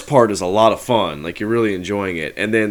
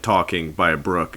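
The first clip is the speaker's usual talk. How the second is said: ordinary talk, nothing marked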